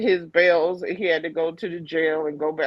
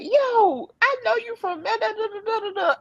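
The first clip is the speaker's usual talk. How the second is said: excited recognition. Retold or imitated. Officer recognizing husband, retold.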